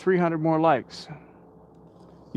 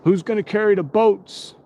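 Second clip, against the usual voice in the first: raised-pitch motivational catchphrase call; David Goggins; 7 semitones higher, 4 dB louder than usual. Retold or imitated. Streamer quoting a motivator to his viewers, high catchphrase impression. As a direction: call it out like a hype man, pitched up. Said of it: "about David Goggins".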